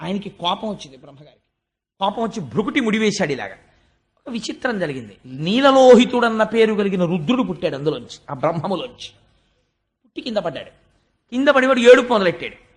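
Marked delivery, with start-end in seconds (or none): none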